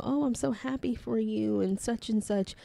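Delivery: high, gushing fake cheer; fake happy. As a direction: gush with hollow delight. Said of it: "fake happy is".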